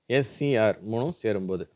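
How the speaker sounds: usual speaking voice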